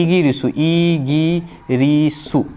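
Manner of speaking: इगिरिसु said slowly twice, every syllable clear; slow careful demo of इगिरिसु; Japanese pronunciation. Shown as a demonstration. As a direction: spell out इगिरिसु by sound, slowly. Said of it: "England क्या बोलेंगे?".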